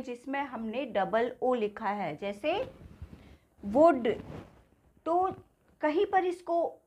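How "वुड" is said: "वुड" with a short, rounded "oo"; short U sound. Shown as a demonstration. Teacher's double-O example, short vowel in वुड. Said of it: "छोटा ऊ की मात्रा से pronounce किया".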